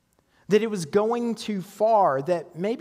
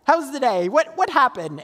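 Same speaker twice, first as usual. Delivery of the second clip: higher, eager wife's greeting; a lightly voiced eager Sarah; Sarah asking eagerly; 7 semitones higher, 3 dB louder than usual. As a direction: play the curious wife, pitched up.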